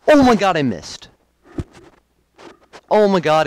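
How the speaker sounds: loud high cry of disbelief; shocked outcry. Real, not acted. Streamer loses to a missed attack, shocked loud outcry. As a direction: yell out as the move misses.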